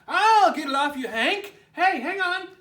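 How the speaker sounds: high, loud, fast eager call; shouted. Acted. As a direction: bounce in to help. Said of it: "she shouted".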